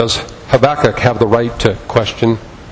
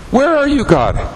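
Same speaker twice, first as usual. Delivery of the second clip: high, pleading cry to God; desperate high plea to God; anguished plea; 11 semitones higher than usual. Acted. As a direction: cry it up to heaven, then drop.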